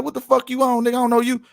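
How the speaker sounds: high-pitched mock confrontation; retold indignant challenge.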